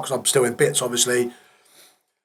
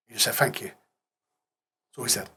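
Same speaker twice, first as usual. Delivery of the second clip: choked up; 2 semitones lower, 9 dB quieter, slower than usual. Real, not acted. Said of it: "you're gonna get me emotional".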